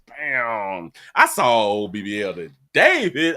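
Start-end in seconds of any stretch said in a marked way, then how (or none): none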